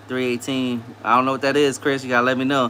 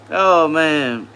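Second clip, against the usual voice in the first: ordinary talk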